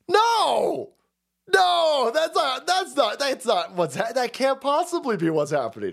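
high, hammed-up cry of disbelief; high protesting no, stammered repeats. Disbelief at the news he retells.

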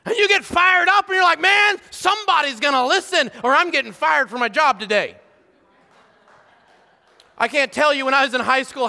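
loud, high, driving preacher's build; fiery preaching. Acted. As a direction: preach it hard to the congregation. Preaching at full force.

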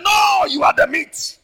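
high, loud triumphant retort; defiant shout. Acted. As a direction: hurl it back at the lion. David voiced defiantly.

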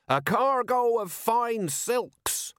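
very high, slow soapbox cry; indignant proclamation. A narrator's reading. Merchant answers the crowd, proclaiming indignantly. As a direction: announce it to the whole square, drawn out.

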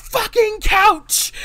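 high, loud, drawn-out mock shout; slow, furious imitated yell; sudden outburst. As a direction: explode out of politeness. Acted outburst after calm request.

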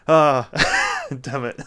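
mock exasperation at being caught; found out. Co-star caught out as a Brummy, mock exasperation. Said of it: "and you're like".

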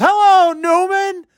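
very high, drawn-out call from the audience; mock heckler yell. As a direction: imitate a fan yelling across rows, slow and high. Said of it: "a few rows away just yells".

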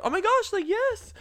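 very high-pitched imitation of a thrilled friend; mock excitement. Friend walking over to agree, high mock excitement. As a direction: squeal it like her excited friend.

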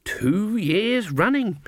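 slow, high mock wonder; mock impressed. A narrator's reading. Granny feigns wonder to the woodcutter, slow and high. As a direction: act impressed, slowly. First take of repeat.

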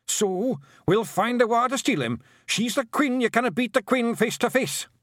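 high-pitched, excitable little pixie voice; high, agitated creature voice; urgent pixie. A narrator's reading. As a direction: argue urgently, high and insistent. Pixie urgently argues with Tiffany.

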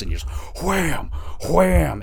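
mock impact. Swinging the hammer.

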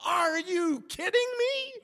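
very high, slow disbelief; hammed-up astonished squeal; mock incredulity. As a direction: stretch it out high, acting appalled. Angler reacts to missing net.

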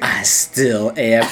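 very low, slow tough-guy delivery; menacing Danton. Retold or imitated. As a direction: draw out each word deep and deadly. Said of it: "Then he goes".

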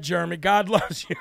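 ordinary talk, nothing marked

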